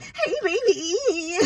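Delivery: put-on high, drawn-out goofy "hey, baby"; hammed-up squeaky playback; mock voicemail playback. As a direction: stretch it out high and silly, laughing. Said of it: "and then they be like".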